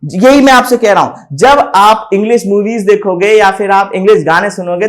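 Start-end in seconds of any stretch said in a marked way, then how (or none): none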